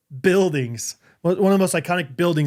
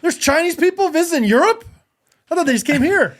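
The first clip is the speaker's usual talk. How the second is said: mock surprise; 12 semitones higher, 4 dB louder than usual. Mock shock at tourist list.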